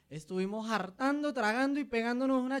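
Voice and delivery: high, gleeful Santander party talk; hammed-up Santander accent, pitched high. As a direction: brag about the feast in Santander slang. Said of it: "Se dice".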